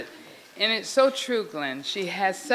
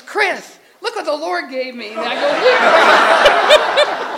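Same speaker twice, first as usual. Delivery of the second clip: higher, louder retold excitement; excited; 6 semitones higher, 11 dB louder, slower than usual. Telling Chris of the found twenty dollars, acted excitement. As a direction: act out bursting to show what turned up.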